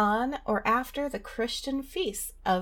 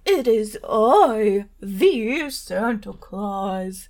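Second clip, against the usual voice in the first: jolly ho ho ho; 2 semitones lower, 6 dB louder than usual. Acted. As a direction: boom it out like Santa. Santa voice skit.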